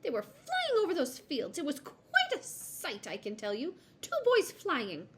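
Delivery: high, loud, gleeful bird; mocking high-pitched magpie gossip; cackling magpie. A narrator's reading. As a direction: crow over a ridiculous sight. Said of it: "cackling with laughter".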